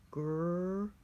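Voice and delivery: "green" stretched sound by sound. Shown as a demonstration. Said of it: "The next word I'm gonna sound out".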